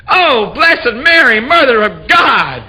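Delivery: very high, loud, slow shout; ecstatic shout. Acted. Woman shouting prayers at climax, very high and loud. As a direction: let her yell nearly take the roof off. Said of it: "she shouted".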